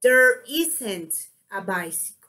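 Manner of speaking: weight on "isn't", falling away on "bicycle"; statement intonation. Shown as a demonstration. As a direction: stress "isn't" and drop "bicycle". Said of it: "You see my intonation?".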